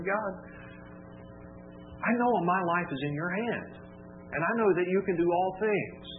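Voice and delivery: ordinary talk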